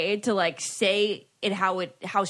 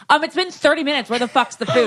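loud, higher-pitched mock complaint; mock abrasive; 5 semitones higher, 6 dB louder than usual. Imitating a friend at a restaurant waiting on food, abrasive. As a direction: imitate a pushy customer demanding service. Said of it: "it's a little abrasive".

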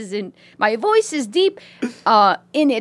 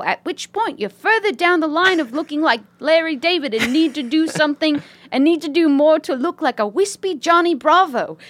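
put-on British lady accent; 3 semitones higher than usual. Reading a listener's email as a British lady, British accent. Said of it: "British lady writing this email".

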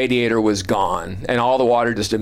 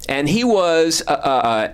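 ordinary talk, nothing marked

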